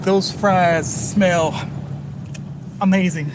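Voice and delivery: slow, drawn-out gushing praise; overdone compliment. Pretend. Pretending to flatter a restaurant, slow gushing. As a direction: stretch every word like a fawning fan. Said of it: "be like".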